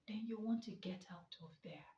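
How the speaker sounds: unvoiced whispered aside. Real, not acted. Telling viewers to escape comfort, whispered. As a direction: breathe the words out.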